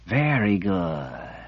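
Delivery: slow, soft, fawning coo. Acted. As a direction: purr it like praising a pet. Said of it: "she cooed".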